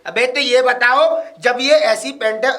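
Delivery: slow down and push each word at her; irritated confrontation. Acted. Husband confronts wife over pants.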